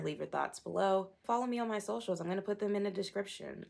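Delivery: ordinary talk, nothing marked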